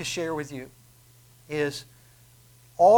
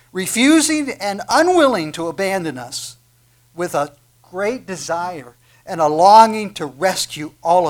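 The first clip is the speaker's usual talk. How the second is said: impassioned reading; 4 semitones higher, 10 dB louder than usual. Shown as a demonstration. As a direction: read it like a love letter from God, full force. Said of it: "We'll read it this way".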